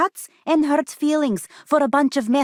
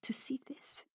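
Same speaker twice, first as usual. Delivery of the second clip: awed, trailing whisper, much softer; stunned whisper; 7 dB quieter than usual. Acted. Doctor shocked at seeing Raphael, whispered. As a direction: barely breathe it, shaken. Doctor shaken by what she sees.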